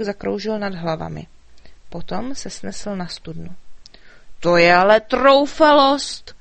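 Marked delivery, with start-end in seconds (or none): 4.4-6.4 loud, slow outraged shout; furious knights. A narrator's reading. Knights raging at the dragon's cheek, loud and slow. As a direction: bellow the outrage slowly. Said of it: "zuřili rytíři".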